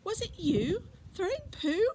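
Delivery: put-on high, loud childish voice; child's voice. Girl asking Fred if he threw it, high childish voice. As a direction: ask like an indignant little girl. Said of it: "the voice was definitely a child's".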